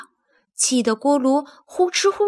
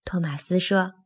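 usual speaking voice

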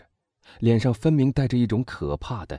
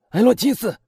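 loud, high-pitched call through a door; anguished shout; 8 dB louder than usual. A narrator's reading. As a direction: shout the name in alarm. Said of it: "他喊道". (焦急地高声喊叫)

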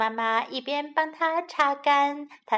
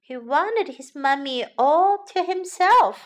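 ordinary delivery

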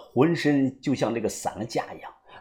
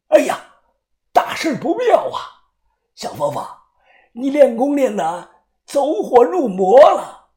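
alarmed madman; 8 semitones higher than usual. Acted. Madman warning his captive of death, high and dramatic. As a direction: ham up the doom.

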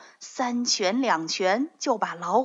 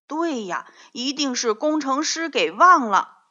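complaining; 4 semitones higher, 5 dB louder than usual. A narrator's reading. Children agree, complaining.

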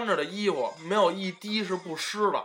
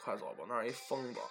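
muttered aside; 6 semitones lower, 14 dB quieter than usual. Retold or imitated. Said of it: "他说".

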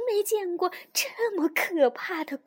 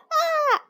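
short high-pitched shriek; little toad squeals in fright; frightened scream; 13 semitones higher, 6 dB louder than usual. A narrator's reading. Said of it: "小蛤蟆尖叫着逃走了".

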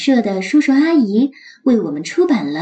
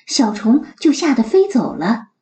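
ordinary delivery, nothing marked

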